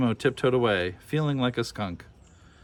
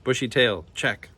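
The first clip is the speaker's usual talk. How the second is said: plain reading voice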